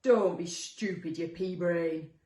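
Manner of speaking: slow, loud monster retort; scornful character voice at normal pitch; annoyed second monster. Acted. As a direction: answer back loudly, drawing out the insult. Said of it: "answered the second".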